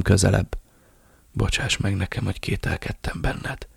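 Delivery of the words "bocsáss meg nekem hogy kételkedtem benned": much softer, half-voiced rasp; dying Ramirez begs forgiveness. A narrator's reading. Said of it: "hörögte". (hörgő, halk suttogás)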